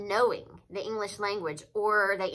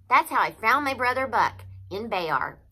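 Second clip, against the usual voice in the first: usual speaking voice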